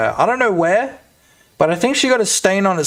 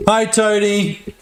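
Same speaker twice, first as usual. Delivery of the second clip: slow prompt; 4 semitones higher, much slower than usual. Shown as a demonstration. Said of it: "say, say".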